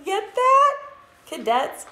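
high, loud excited question; eager check-in. Real, not acted. Host checks the cadets got the joke, excited and loud.

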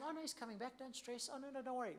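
hushed, soothing mock reassurance; calm them down softly, half mocking. Disciples soothing each other, hushed and calm.

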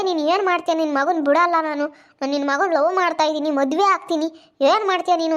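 loud mimicry of a defiant girl; brazen defiance. Retelling a girl challenging her lover's mother, brazenly defiant. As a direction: throw out her challenge loudly, indignantly imitating. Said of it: "ಈಗಲೇ ಈ ಪಟ್ಟಿ ಮಾತಾಡ್ತಾಳೆ".

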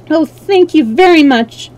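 high-pitched, grateful exclamation; a girl's relieved thank-you; grateful cry. A narrator's reading. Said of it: "cried Dorothy".

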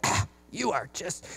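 soft, slow exasperated groan; muttering in frustration at the boss. Retold or imitated. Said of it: "You're like".

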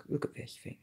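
hushed, breathy awe; whispering. Real, not acted. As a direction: whisper it in admiration. Admiring the figure up close.

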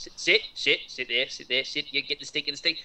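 put-on Australian accent, scolding a dog; Australian accent telling off the dog; Australian yelling at a dog. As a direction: bark orders at a dog like an Aussie. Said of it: "If you were yelling at a dog, go".

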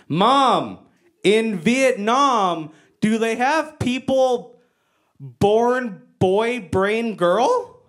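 halting, careful question with long drawn words; simplified English. Retold or imitated. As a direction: spell it out word by word for mom. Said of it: "I don't really speak Vietnamese".

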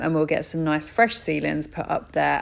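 ordinary delivery

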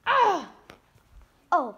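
usual speaking voice

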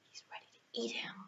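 hushed whisper, fast. A narrator's reading. Telling the children the danger, in a quick whisper. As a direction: whisper it like a spooky secret. Scary moment told to kids.

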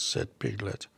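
ordinary delivery

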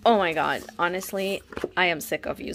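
ordinary talk

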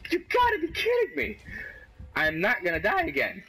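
very high yelling, easing slightly at the end; exasperated outburst. Real, not acted. Player fed up with dying again, exasperated outburst. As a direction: squawk in outraged disbelief.

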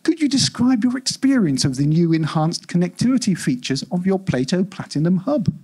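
ordinary delivery

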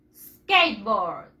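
SKATEBOARD as two slow English syllables, "skeit-bord", falling low; English pronunciation model. Shown as a demonstration. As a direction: model SKATEBOARD for repeating, drawn out and dropping in pitch. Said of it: "Repeat after me".

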